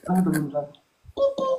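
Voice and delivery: put-on high cutesy girl voice saying coucou; imitating a girl. Advising a guy, he mimics the girl's text in a high girly voice.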